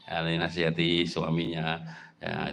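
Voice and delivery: usual speaking voice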